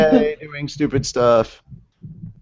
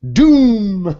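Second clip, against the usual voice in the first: booming one-word blast; dramatic doom shout; 8 semitones higher, 7 dB louder than usual. Acted. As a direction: hit it like a huge impact. Dramatic attack sound.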